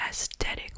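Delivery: "aesthetic" with a hard T in the middle, as as-TET-ic; trendy pronunciation. Shown as a demonstration. Questioning how everyone says it, "aesthetic" whispered as as-TET-ic. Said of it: "Why is it that everyone is pronouncing it".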